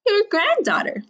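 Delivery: put-on high girlish answer; little girl voice. A narrator's reading. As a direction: announce yourself sweetly as the girl. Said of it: "Little Red said".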